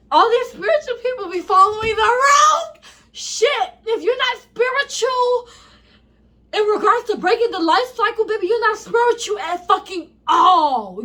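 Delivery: high, loud exasperated rant; fired up and half laughing at them; hyped. Real, not acted. Scolding spiritual people online, hyped and loud. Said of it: "I'm getting so hyped".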